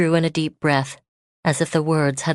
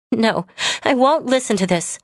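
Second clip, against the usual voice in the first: upset refusal; 6 semitones higher than usual. Acted.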